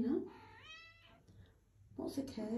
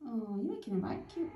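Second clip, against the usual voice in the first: usual speaking voice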